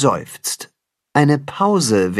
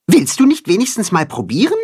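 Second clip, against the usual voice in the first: higher, quick chirpy bird voice; lightly voiced chirpy bird; Timme offering; 9 semitones higher, faster than usual. A narrator's reading. Timme offers Max a berry, quick and chirpy. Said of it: "fragt er".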